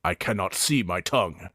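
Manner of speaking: a hulking orc sizing them up; big orc Mountain. Acted. Mountain answers literally.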